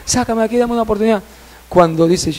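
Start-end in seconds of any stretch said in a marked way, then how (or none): 0.0-1.2 high, soft pleading; small high-pitched retold clamour; child crying out. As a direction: imitate a child begging to be rescued. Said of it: "el niño seguía clamando".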